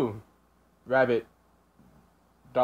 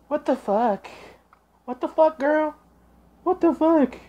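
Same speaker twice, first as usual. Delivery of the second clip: reacting to a cringe clip, high and stunned; stunned outburst; 13 semitones higher, slower than usual. Real, not acted. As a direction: blurt it out in shock at the screen.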